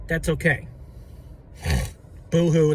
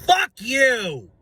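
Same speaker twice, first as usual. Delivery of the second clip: higher, louder furious outburst; angry yell; 6 semitones higher, 4 dB louder than usual. Acted. Fan cursing out Jalen Hurts doubters, yelling. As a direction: spit it at them.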